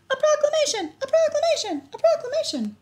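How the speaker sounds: high, loud, repeated cries; excited shouting. A narrator's reading. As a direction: shout it in a thrilled panic. Wilbur panicking at news.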